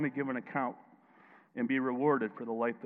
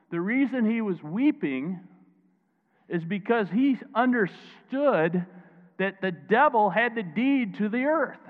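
slow, loud, emphatic preaching; 6 semitones higher, 5 dB louder, slower than usual. Acted. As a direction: drive each word home slowly to the crowd. Preacher at full force, slow.

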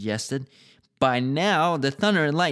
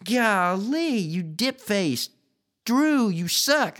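high, indignant outburst, slowing into drawn-out insults; hammed-up exasperation; flushed outburst; 9 semitones higher, slower than usual. A narrator's reading. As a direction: rant in disbelief, pitched way up. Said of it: "Michael's face flushed with".

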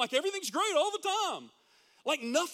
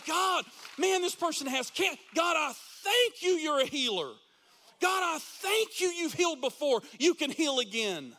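fervent prayer; 3 semitones higher, 4 dB louder than usual. Shown as a demonstration. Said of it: "we start every prayer with thanksgiving".

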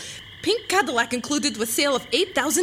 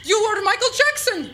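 excited exclamation; 6 semitones higher, 4 dB louder than usual. Acted. Mistaken identity exclamation.

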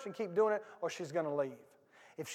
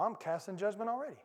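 ordinary talk, nothing marked

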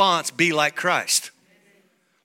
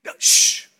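unvoiced playful shush; 4 dB louder than usual. Pastor hushing the women, whispered shush. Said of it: "Women, women".

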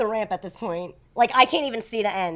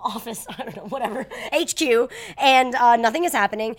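usual speaking voice

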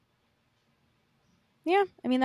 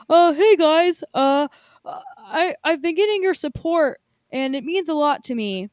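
lightly imitated stage speech, louder, hesitant start; mock a halting address to fans, a little louder; Tom Holland; 4 semitones higher, 5 dB louder than usual. Imitating an actor thanking fans on stage, lightly put-on. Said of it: "what did Tom Holland do while he was on stage".

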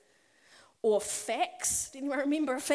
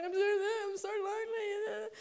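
higher, tearful wail of loneliness; lonely young missionary crying to her parents; retold crying; 8 semitones higher, 4 dB quieter than usual. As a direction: cry it down the phone to your parents. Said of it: "crying, going".

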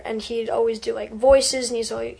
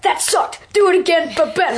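blunt director; 6 semitones higher, 5 dB louder than usual. Retold or imitated. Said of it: "They're just like".

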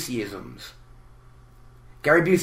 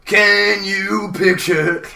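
very slow, higher, louder hippie drawl; Doctor Teeth impression; 6 semitones higher, 5 dB louder, much slower than usual. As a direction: stretch it like a bandleader. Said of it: "talk like Doctor Teeth".

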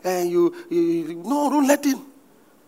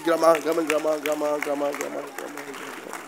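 mock babbling; 7 semitones lower, 4 dB quieter than usual. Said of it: "imitating tongues-speakers' babble".

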